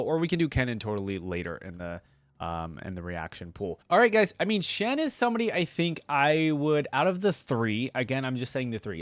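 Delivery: ordinary talk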